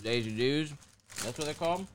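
eating while talking; mouth full. Real, not acted. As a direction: talk through a mouthful. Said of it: "Sorry, I'm eating Thin Mints".

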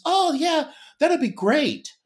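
high imitation of his wife; man doing a woman's voice. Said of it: "I love the impression of your wife".

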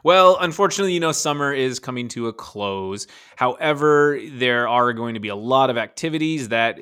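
ad read. Acted. Reads the ad break.

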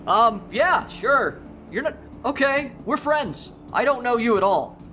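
high, hesitant voice of a confused man; timid agreement. Acted. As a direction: go along meekly while unsure. Confused John answers Evan.

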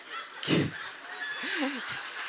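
soft, breathy burst of laughter; helpless little laugh. Real, not acted. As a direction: laugh quietly at yourself. Breaks up over cute boy remark.

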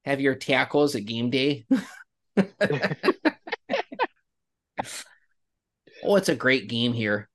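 playful Upper Midwest lake accent, sing-song and friendly; hammed-up Wisconsin-ish lake accent; Minnesota accent. Shown as a demonstration. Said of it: "lake accents you can do".

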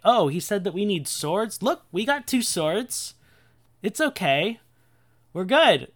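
high, chirpy mock cluelessness; hammed-up, high-pitched reassurance; clueless disciples. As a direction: play them as proudly oblivious. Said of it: "they don't get it. They're, they're like".

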